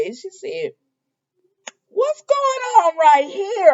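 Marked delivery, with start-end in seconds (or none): 1.9-3.7 high, loud, slow imitated questioning; mock suspicion. Susan confronting the two about vibes, high mock suspicion. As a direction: stretch it out with hammed-up suspicion.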